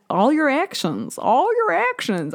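high, slow Southern drawl; Southern accent. Real, not acted. Podcaster slipping by accident into a Southern accent on her words. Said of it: "why the Southern accent comes out".

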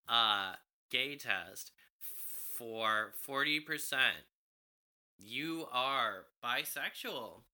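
ordinary talk, nothing marked

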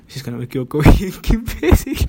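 words breaking into a laugh; real giggle, pitch jumping at the end; laughing at oneself. Real, not acted. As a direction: crack up while mocking your own slip. Cracks up at own phrasing.

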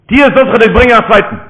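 high, loud insistent command; shouted order. Acted. Grandfather bargaining with his grandson, loud and insistent. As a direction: push the offer hard at full voice.

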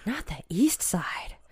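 hushed horror; shocked whisper. Acted. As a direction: gasp then whisper it. Gasped whisper reply as mom.

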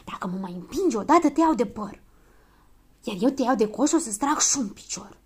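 threatening squabble, partly whispered; furious hiss. A narrator's reading. Children threatening each other behind the door, hissing. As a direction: trade hissed threats with some force. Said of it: "Se auzi un șuierat furios".

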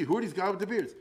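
ordinary talk, nothing marked

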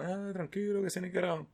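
high, airy mocking brush-off; mock dismissive pitcher. Imitating the pitcher brushing off Bregman, mock dismissive.

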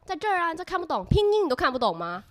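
mock the classmate scoffing at her for not reading pinyin; confrontational Beijing accent. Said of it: "北京口音听起来就是很像在呛你".

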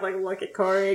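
usual speaking voice

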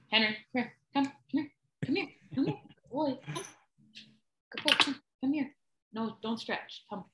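high coaxing pet voice; talking to a dog. Real, not acted. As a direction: sweet-talk the dog to come over. Calling the dog over.

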